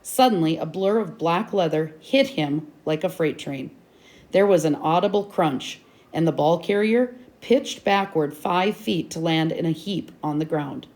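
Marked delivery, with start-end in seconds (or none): none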